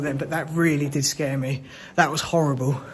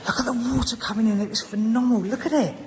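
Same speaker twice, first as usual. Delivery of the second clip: pitched up and amazed; excited; 7 semitones higher than usual. Real, not acted. Explorer showing viewers the flooding, excited. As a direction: point it out, thrilled.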